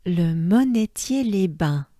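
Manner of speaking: Le monetier les bains said quickly, the French way; fluent French Le monetier; native French. Shown as a demonstration. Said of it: "I'll say it as a French person".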